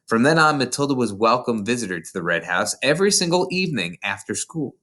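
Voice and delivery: usual speaking voice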